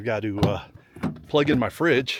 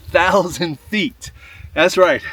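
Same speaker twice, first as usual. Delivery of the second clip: winded in thin air; short of breath, thin mountain air; breathless at high altitude; 4 semitones higher, 5 dB louder, slower than usual. Real, not acted. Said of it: "if you hear me being a little winded".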